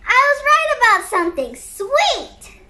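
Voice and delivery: high, quick burst of delight; delighted exclamation. Acted. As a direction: exclaim it proudly, falling at the end. Kid delighted at being right.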